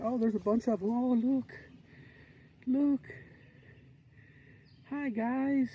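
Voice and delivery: high, soft pet-talk voice to the deer; cooing at animals. Real, not acted.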